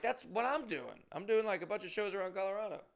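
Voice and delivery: delighted we're doing the same thing; excited. Retold or imitated. Comic telling his friend they're both touring, excited.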